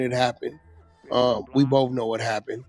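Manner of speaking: ordinary talk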